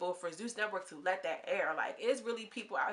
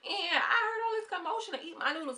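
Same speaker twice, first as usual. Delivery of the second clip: mock Jocelyn; 10 semitones higher than usual. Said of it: "Jocelyn comes back with her shoes off".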